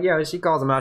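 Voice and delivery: ordinary talk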